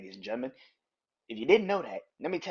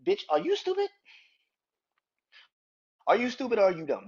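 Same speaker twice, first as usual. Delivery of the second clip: incredulous jab; 5 semitones higher, faster than usual. Real, not acted. Ranting at fans.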